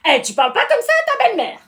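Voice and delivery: high, loud telling-off; scolding. Acted. Scolding a stepchild for rude talk, indignant. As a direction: snap indignantly at cheek.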